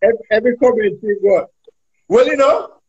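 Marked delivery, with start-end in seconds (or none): 2.1-2.7 higher, ad-libbed commentator ramble; imitating Oral Tracy. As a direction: do his rambling commentary opener. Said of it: "always start every commentary by saying".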